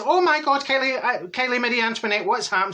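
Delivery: high, retold shocked reaction. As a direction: act out hearing dreadful news.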